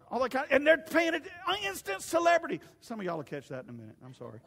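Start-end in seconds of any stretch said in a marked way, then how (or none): 4.0-4.4 softer, quick aside to the congregation; sheepish apology. Real, not acted. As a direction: step back and apologize lightly. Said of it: "I know I'm a little excited today".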